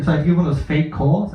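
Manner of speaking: ordinary talk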